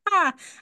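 hammed-up witch cackle; bad witch cackle. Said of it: "a really bad witch laugh".

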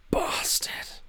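whispered angry swear; muttered curse. A narrator's reading. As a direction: hiss it under your breath. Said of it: "muttering to himself".

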